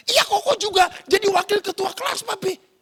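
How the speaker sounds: put-on high, loud boy chiming in; eager child sharing news; excited older brother. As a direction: pipe up proudly to daddy, pitched high. Acted older brother adding his news.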